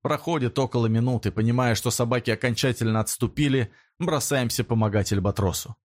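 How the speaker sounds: ordinary delivery, nothing marked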